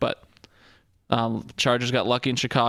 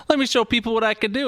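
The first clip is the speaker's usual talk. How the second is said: higher, keen mock boast; eager quarterback; 9 semitones higher, 3 dB louder than usual. Mocking the quarterback as an eager show-off. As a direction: play the quarterback showing off. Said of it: "Fitzpatrick's like".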